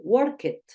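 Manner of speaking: "work it": worked in two syllables, work-ed; wrong -ed ending. Shown as a demonstration. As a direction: say "work it", sounding the E of -ed fully. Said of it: "no es indicado decir". ("work it" con la e de -ed pronunciada, en dos sílabas)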